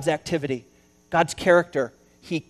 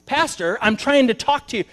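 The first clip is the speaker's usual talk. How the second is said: higher, louder protest to the pastor; exasperated man; 6 semitones higher, 4 dB louder than usual. Retold or imitated. Man to pastor pushing the book, exasperated. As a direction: get his attention, exasperated. Frustrated visitor calls to pastor.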